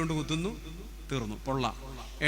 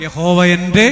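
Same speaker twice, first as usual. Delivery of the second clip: fervent cry; 2 semitones higher, 17 dB louder, slower than usual. Acted. Quoting psalm with fervour.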